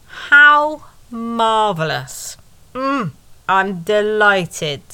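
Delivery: slow, drawn-out dry sarcasm; sarcastic. Acted. Narrator mocking the awkward date, slow dry sarcasm. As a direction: savour each word with mock delight.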